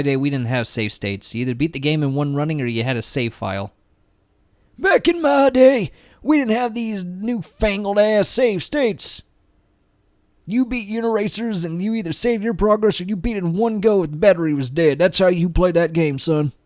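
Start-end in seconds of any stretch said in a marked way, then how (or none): 4.8-16.5 put-on crotchety old-timer lecturing the young; mock old-timer. Gamer scolds youngsters about save states, mock old-timer.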